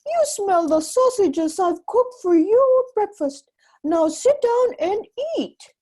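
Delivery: high, loud bossy housewife; giant's wife bossing. Acted. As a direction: order him to the table. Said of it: "the giant's wife said".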